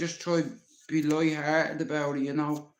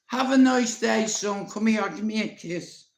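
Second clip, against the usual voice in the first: higher, sugary goodbye voice; sweet granny; 7 semitones higher, 4 dB louder than usual. Retold or imitated. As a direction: turn suddenly sweet like a doting granny.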